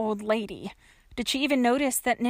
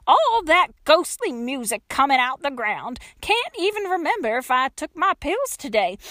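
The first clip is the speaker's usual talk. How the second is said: put-on higher, louder folksy old-lady voice; dotty old woman, scolding; 4 semitones higher, 5 dB louder than usual. A narrator's reading. Old woman grumbles at girl in the grave, dotty scolding.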